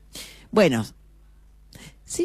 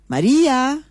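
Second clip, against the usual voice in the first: calling out; 10 semitones higher than usual. Acted. Husband calls his wife from bedroom.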